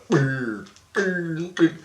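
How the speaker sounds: high trilled "brr" bursts; imitated head rattle. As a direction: mimic three punches rattling inside the skull. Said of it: "My head went".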